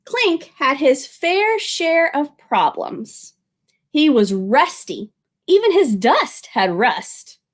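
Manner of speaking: slow, expressive read-aloud; children's story narration; storybook narration. A narrator's reading. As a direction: read it slowly to little ones.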